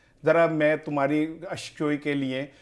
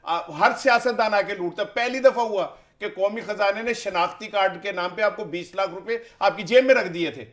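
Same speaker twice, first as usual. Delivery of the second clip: ordinary delivery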